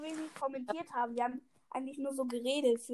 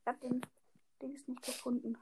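whispering; 7 dB quieter than usual. Real, not acted. Mutters to himself.

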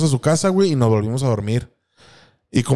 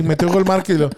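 ordinary talk

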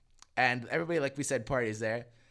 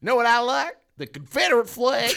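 loud, high mock country twang; country accent; 10 semitones higher, 10 dB louder than usual. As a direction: crow it in a hammed-up country accent. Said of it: "like, a country accent for it".

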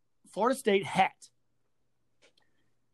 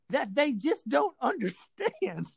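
slow words broken by a laugh; laughing while telling; 3 semitones higher, slower than usual. Real, not acted. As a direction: laugh through the punchline in disbelief. Laughs retelling absurd remark.

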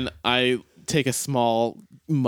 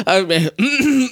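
ordinary delivery

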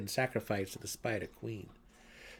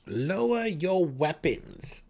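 slow, pointed, higher repeat of the order; more pointedly; 7 semitones higher, 6 dB louder, slower than usual. A narrator's reading. Brisa repeats her order to Drizzt, pointed and slow. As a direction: press each word home. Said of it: "more pointedly".

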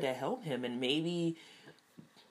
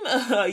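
loud high laugh; 9 semitones higher, 12 dB louder than usual. Real, not acted. Laughing at her own superhero-team hopes.

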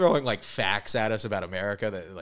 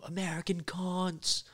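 high, loud, slow barking order; hammed-up hostile local shouting at the Americans; mock foreign yell; 11 semitones higher, 6 dB louder, slower than usual. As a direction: wake them up by shouting like an angry local. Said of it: "they go".

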